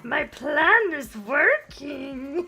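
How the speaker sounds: drawn-out, rising villain gloat; mock scheming villain. Villain boasting of a plan to unite everybody, mock scheming. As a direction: gloat slowly like a cartoon mastermind.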